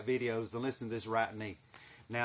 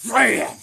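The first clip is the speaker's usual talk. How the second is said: loud, high impact sound; explosive bam; 7 semitones higher, 12 dB louder than usual. Retold or imitated. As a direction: slam the word out suddenly. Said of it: "and I went".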